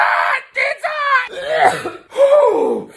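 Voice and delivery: high choking cough with a pained laugh; coughing laugh-cry. Real, not acted. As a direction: cough and sob through the burn. Choking on hot smoothie.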